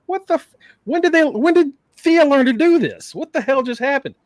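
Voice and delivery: shocked by the move; stunned disbelief. Real, not acted. Fan reacts to a surprise move, stunned.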